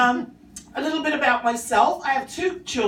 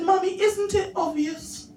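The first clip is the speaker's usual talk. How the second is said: put-on higher smug child voice; little boy voice; 8 semitones higher than usual. Son lecturing his mum that it's obvious, high and smug. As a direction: act like a know-it-all toddler.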